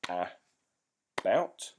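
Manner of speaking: a-bout in two separate beats; clapped syllables. Shown as a demonstration. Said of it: "you clap out the syllables".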